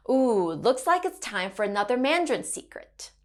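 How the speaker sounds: normal talking voice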